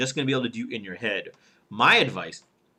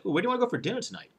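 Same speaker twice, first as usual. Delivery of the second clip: quick, high question lifting at TONIGHT; questioning rise; 8 semitones higher, 4 dB quieter, much faster than usual. Shown as a demonstration. Coach demonstrating a question about dinner, rising tone. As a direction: ask it lightly with the tone going up. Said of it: "Tone goes up a little bit".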